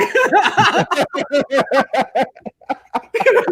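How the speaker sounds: long bursting laugh. Real, not acted. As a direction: laugh through it. Laughing at own story.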